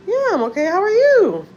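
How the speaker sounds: bright high brush-off, voice jumping up; fake cheerful. Brushing off the kind lady with high fake cheer. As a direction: act fine, chirp it out high.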